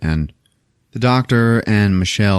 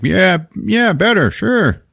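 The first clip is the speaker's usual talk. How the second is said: higher, shifty yeah yeah; evasive high voice; 10 semitones higher, much slower than usual. Retold or imitated. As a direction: wave it off evasively. Doctor brushes off question evasively.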